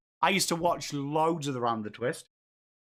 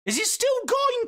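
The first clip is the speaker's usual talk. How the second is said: surprised; 4 dB louder than usual. Real, not acted. Surprised question to chat.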